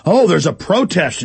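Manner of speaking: normal talking voice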